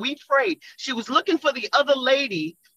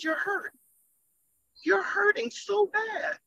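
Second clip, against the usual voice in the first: high, soft, aching retelling; tearful compassion; 9 semitones higher, 4 dB quieter than usual. Retold or imitated. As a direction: reenact hurting for her, pitched up and drawn out. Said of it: "tears came in my eyes".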